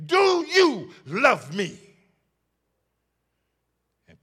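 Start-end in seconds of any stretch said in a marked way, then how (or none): 0.1-1.8 high, loud, drawn-out plea; Jesus pressing Peter, loud and slow; Jesus pleading. Acted. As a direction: cry it out slowly, reaching. Jesus asks Peter, cried out.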